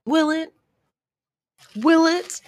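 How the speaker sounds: mock the promise with rising disbelief; sarcastic doubt. Real, not acted. Sarcastic reply to the cliché.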